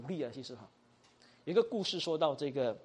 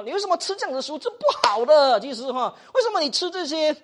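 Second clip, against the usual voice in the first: high, loud scolding criticism; mock reproach; 8 semitones higher, 9 dB louder than usual. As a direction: pounce on someone's eating habits, raised voice. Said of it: "不要一开始就跟他说嘞哈". (高声责备)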